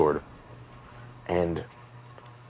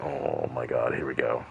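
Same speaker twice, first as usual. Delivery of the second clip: weary here we go; 6 semitones lower, 6 dB quieter than usual. Retold or imitated. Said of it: "I'm like".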